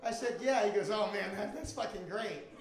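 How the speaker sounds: normal talking voice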